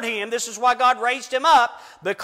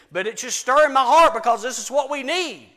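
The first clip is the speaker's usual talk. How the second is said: ordinary talk, nothing marked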